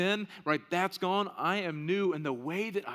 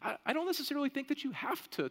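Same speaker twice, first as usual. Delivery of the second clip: a lightly voiced objector, higher pitch; mock objection in a higher voice; imagined objector; 10 semitones higher, faster than usual. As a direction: raise the pitch slightly protesting, as someone arguing back. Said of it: "So to the person that says".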